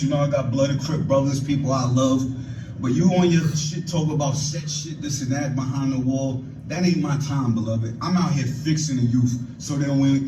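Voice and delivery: ordinary delivery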